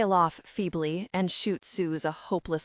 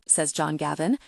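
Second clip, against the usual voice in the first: ordinary delivery, nothing marked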